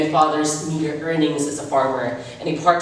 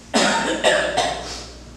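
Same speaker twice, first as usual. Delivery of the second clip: weak cough. Acted. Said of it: "and said".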